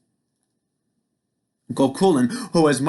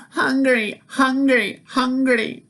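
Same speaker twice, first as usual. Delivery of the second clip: put-on much higher whiny baby cries; hollering baby; 12 semitones higher, slower than usual. A narrator's reading. Finn as baby demanding food, high wail. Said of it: "The baby began to holler too".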